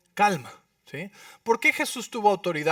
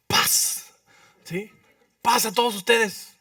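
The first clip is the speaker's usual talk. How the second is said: mock blessing; 4 semitones higher, 4 dB louder, slower than usual.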